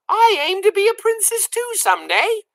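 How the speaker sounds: very high hopeful little voice; high childlike hope; dreamy girl. A narrator's reading. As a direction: state your dream proudly. Mig's dream.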